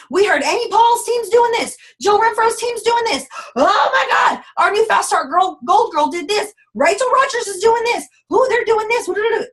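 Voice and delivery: high, loud, breathless hype about other teams; frantic hype. Retold or imitated. Mocking a recruit chasing every team's trend, high and loud. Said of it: "gossiping about other teams".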